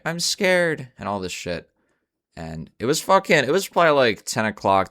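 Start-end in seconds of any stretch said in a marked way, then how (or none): 0.0-0.9 imitating scared woman. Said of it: "she was like".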